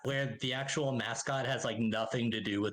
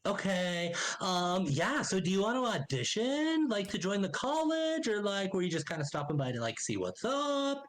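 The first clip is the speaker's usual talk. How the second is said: high, singsong upspeak; bubbly Gale voice, stretched endings; perky halfling; 7 semitones higher than usual. Acted. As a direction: invite them in a high, lilting voice. Gale invites newcomer to audition.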